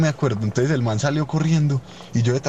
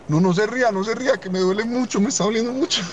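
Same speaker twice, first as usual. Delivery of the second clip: imitating a whiny high plea, much higher; mock pained plea; 10 semitones higher than usual. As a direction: beg in comic pain. Friend in pain begs not laughing.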